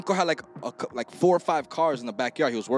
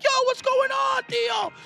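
put-on kid voice; 4 dB louder, slower than usual. Kids asking uncle, retold.

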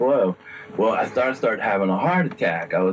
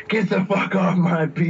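high-pitched mock devil command; lightly played angry devil, pitch well up; big devil voice; 9 semitones higher than usual. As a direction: imitate the devil kicking you out. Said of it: "then the voice says".

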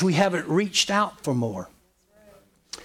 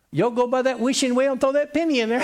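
quick, higher-pitched, breaking into laughter; laughing tease; 10 semitones higher, faster than usual. Real, not acted. Preacher teases congregation about wishing wells, laughing. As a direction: tease them fast till the laugh takes over.

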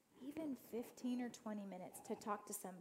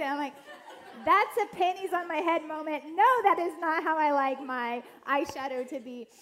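louder, higher, fired-up sermon voice; preaching; 5 semitones higher, 10 dB louder than usual. Acted. Urging listeners about getting it wrong, preaching loud. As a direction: drive the point home with rising fervor.

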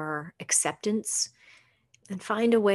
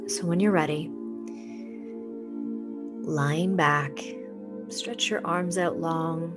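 soft spa whisper; 5 dB quieter than usual. Acted. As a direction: ease them into calm, unhurried. Leads a guided meditation.

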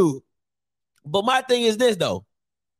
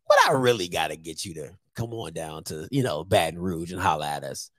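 low, hushed sweet-talking pitch; butter up the coach quietly; mock recruiter, low and soft; Southern recruiter; 5 dB quieter than usual. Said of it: "I'm like".

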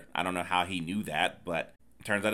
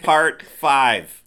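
loud, higher title shout; section announcement; 4 semitones higher, 11 dB louder than usual. Pretend. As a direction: belt it out like a title card.

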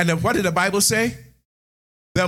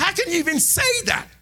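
indignant shout; 13 semitones higher than usual. Real, not acted. Preacher rebukes cruel words.

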